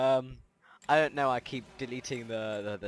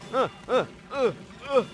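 panicked stammer; 12 semitones higher, 10 dB louder than usual. Real, not acted. Panic in fight.

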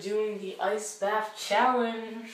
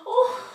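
high gasp; 12 semitones higher, 4 dB louder than usual. Real, not acted. Gasp in freezing water.

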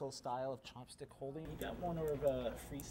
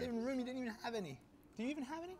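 incredulous; 12 semitones higher, 3 dB louder, faster than usual. Real, not acted. Incredulous teasing about tripe.

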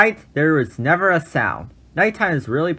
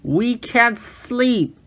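complaining; 10 semitones higher, much slower than usual. Acted. Animals complain.

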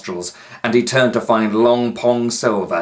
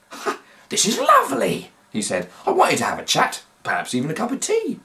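a hint of beaming professor; 12 semitones higher, faster than usual. A narrator's reading. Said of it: "McMoo just beamed".

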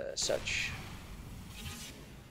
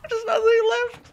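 mock wail; 8 dB louder than usual. Mock despair over a botched circumcision joke.

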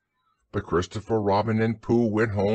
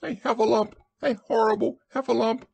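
high, frightened squeal; panicked Piglet cry; 13 semitones higher, faster than usual. A narrator's reading. Piglet raises the alarm about the monster, high panicked cry. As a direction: squeal the alarm like a tiny creature. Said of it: "cried Piglet".

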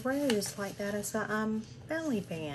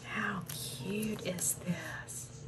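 slow, soft, awed whisper; 7 dB quieter, much slower than usual. Real, not acted. Charmed by the gift, whispering slowly. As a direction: breathe it out, charmed.

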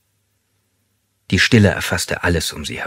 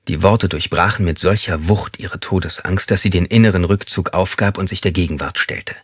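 ordinary delivery

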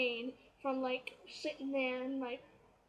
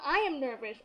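usual speaking voice

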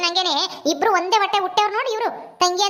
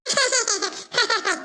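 playful giggling in short bursts; little girl's giggle; 3 dB quieter than usual. Acted. Little girl laughing when asked to play ball, giggly. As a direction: giggle like a small child. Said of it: "ಅಂತ ನಗ್ತಾಳೆ".

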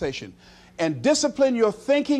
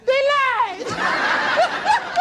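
high laughing "they lied"; laughing mock complaint. Preacher mimicking the crowd, laughing. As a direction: laugh it out as if betrayed.

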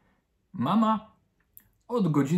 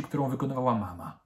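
ordinary delivery, nothing marked

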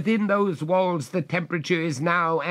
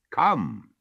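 commanding; 7 semitones higher, 4 dB louder than usual. A narrator's reading. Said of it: "a tone of command".

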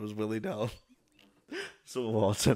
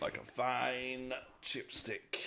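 mock connoisseur; 3 semitones higher, 12 dB quieter, much slower than usual. Echoing the joke in a put-on voice.

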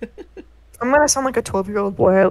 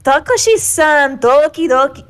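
put-on higher, louder cutesy anime girl; 6 semitones higher, 6 dB louder than usual. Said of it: "fucking anime girl voice".

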